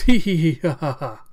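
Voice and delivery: very loud high giggle dropping to a laugh; villain cackle. Acted. Cyborg answers old man's plea, mocking cackle. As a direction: mad acted cackle.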